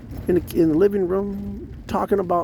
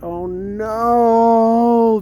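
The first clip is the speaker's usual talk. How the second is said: loud, drawn-out mock dismay; 6 semitones higher, 7 dB louder than usual. Recalling being glared at after church, dismayed. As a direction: stretch it out, high and loud, in comic dread. Acted dismayed reaction to the stare.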